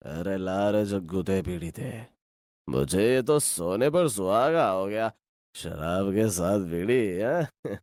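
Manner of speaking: put-on low, slow drunken slur; drunk old man. Drunk Kaliya asks boys for a bidi, slow slurred drunk voice. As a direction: drawl it out like a tipsy old drunk.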